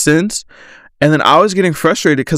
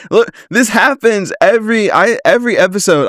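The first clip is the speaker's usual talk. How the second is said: breathy laugh, then higher and quicker; startled laugh; 6 semitones higher than usual. Real, not acted. Host jump-scared mid-episode by a notification, laughing it off higher. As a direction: laugh off a sudden fright.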